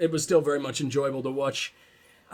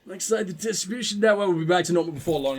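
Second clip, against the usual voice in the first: hammed-up twitchy addict, high-pitched; meth addict voice; 5 semitones higher than usual. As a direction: play a jittery addict. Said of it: "I'm done with the meth addict voice".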